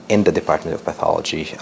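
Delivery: normal talking voice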